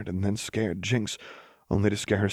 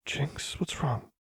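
higher and softer, concerned; worried, soft; 8 semitones higher, 5 dB quieter, slower than usual. A narrator's reading. Lux asking Jinx what's wrong, softly. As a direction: ask gently, alarmed. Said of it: "Lux asked".

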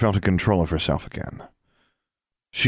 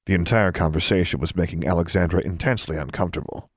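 ordinary delivery

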